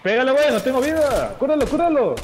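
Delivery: high, urgent plea for a heal; panicking gamer. Real, not acted. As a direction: pitch up in panic, begging for help. No health, begging for heal.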